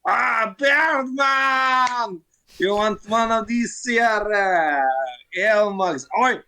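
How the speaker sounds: a rowdy Mario calling for ale; boisterous dwarf. Acted. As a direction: hail the bartender like a merry drunk. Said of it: "Mario goes".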